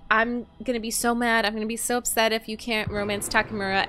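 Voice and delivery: usual speaking voice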